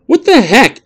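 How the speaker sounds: put-on high, loud angry kid; spat out. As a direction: spit the words at the machine. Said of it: "Adam spat at the machine".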